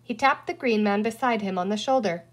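usual speaking voice

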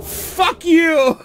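high, loud curse through real laughter; laughing triumph. Real, not acted. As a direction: laugh in the beaten boss's face. Mocking the beaten boss.

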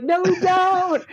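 high, slow, playful no; defiant refusal. Retold or imitated. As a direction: drag it out cheekily. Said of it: "We're like".

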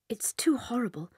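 a soft, breathy whisper of remembered horror; hushed dread. Acted. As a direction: whisper it as if you can't bear to say it. Recalling her cruel aunt.

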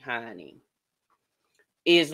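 normal talking voice

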